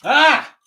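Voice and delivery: sudden high startled yelp; startled shriek. Real, not acted. Streamer spots a face at his window and yelps in fright. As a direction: jump out of your skin with a shriek.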